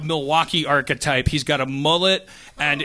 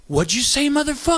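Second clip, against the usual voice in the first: tough guy threat; 8 semitones higher than usual. Retold or imitated. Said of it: "does the guy go".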